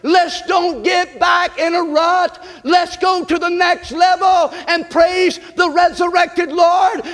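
fiery revival preaching; preaching at full force. Acted. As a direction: whoop it up from the pulpit. Preacher builds to full shout.

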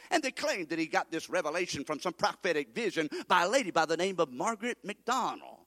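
normal talking voice

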